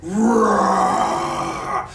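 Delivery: very low, growling bear roar; deep demo bear growl. Shown as a demonstration. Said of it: "A bear goes".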